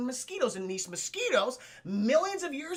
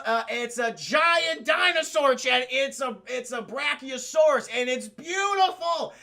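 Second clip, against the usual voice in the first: awestruck shouting; 3 semitones higher, 5 dB louder than usual. Real, not acted. Streamer revealing the dinosaur to chat, loud and thrilled. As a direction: shout it out in overjoyed wonder.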